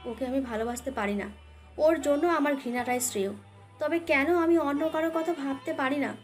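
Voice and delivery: plain reading voice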